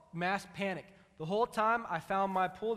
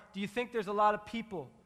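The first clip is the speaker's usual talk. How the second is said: ordinary talk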